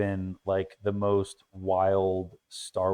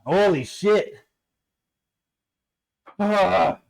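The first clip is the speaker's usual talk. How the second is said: after smelling salts; 9 semitones higher, 4 dB louder, much slower than usual. Real, not acted.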